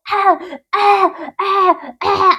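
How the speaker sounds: repeated high "ah" of revulsion; imitated disgusted gagging. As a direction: act out gagging on a foul taste. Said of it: "Una rata muerta. Yo así como".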